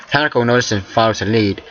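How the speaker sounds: usual speaking voice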